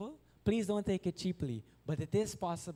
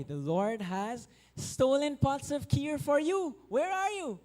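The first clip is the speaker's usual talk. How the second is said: very high calling shout across the market; priest shouting; 10 semitones higher, slower than usual. Acted. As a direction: call out for him, high and urgent. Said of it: "shouting for Madhavendra Puri".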